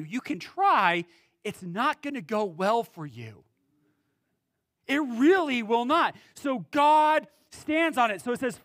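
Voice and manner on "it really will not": usual speaking voice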